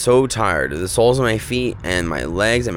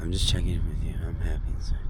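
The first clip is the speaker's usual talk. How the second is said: soft breathy whisper to the camera; 3 semitones lower, 9 dB quieter, faster than usual. Real, not acted. Checking in with viewers at night, whispered. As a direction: confide it in a whisper.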